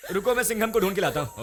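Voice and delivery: ordinary talk, nothing marked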